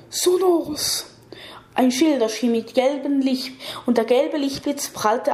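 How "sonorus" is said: lightly croaked spell, pitched higher; croaky voice. A narrator's reading. Old man casts a shield against the attack, mild croaky voice. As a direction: croak out the incantation a little. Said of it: "sagte mit einer Krächzstimme".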